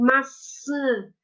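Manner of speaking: "maçã" with the final ã sounded through the nose; nasal vowel demo. Shown as a demonstration. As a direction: show the nasal ã at the end of "maçã". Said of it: "parece que fala um pouquinho pelo nariz". ("maçã" com o ã final bem nasal)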